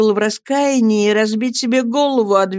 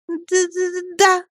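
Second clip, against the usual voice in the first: high, halting little yes; timid stammer; 11 semitones higher than usual. A narrator's reading. Dora answers her scolding husband, timid stammer. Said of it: "робко пробормотала Дора".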